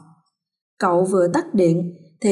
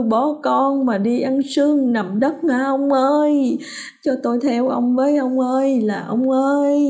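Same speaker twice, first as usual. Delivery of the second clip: mock wailing lament; 5 semitones higher than usual. A narrator's reading. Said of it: "mụ Thẩm cũng gào lên cho mọi người cùng nghe".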